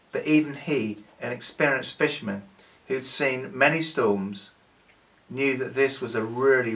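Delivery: plain reading voice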